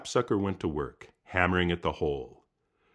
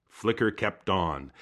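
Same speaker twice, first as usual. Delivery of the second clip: usual speaking voice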